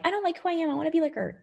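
high, mock whiny lament; a hammed-up wail of not liking yourself; mock self-doubt. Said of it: "Not that I'm like".

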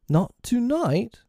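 high, drawn-out bewildered question. A narrator's reading. Lost boys hearing Wendy may leave, bewildered. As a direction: ask in high, slow disbelief.

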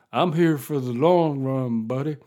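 put-on his belly talking back in a lazy American Deep South accent. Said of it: "stomach is a racist from the American Deep South".

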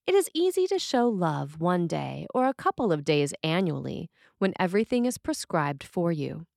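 normal talking voice

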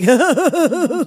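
a quick imitated giggle at insolence. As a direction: mimic the Kapellmeister's tittering laugh. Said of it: "his little giggle where he, like".

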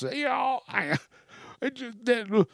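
high whiny mock bride asking for a refund. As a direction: squeak it like a clueless customer. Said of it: "this woman's face and hung up".

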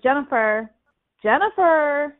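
dad hollering for Jennifer; calling out. Retold or imitated. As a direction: call her name across the camp.

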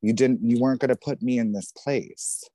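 ordinary delivery

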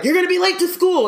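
high, fast, urgent warning; urgent wake-up call. Retold or imitated. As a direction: rush the kid out of bed. Brother voiced in wake-up story.

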